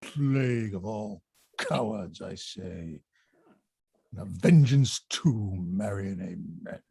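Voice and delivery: low, muttered curse; grumbling. Acted. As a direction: grumble under the breath. Grumbling Falstaff, low and quiet.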